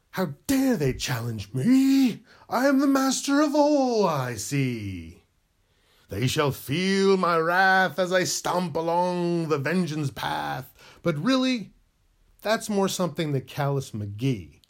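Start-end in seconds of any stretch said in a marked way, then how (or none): 0.1-10.7 slow, loud and higher, a hammed-up tyrant; villainous rant. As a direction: boom it out like a cartoon overlord. Villain rant as Callus McGee.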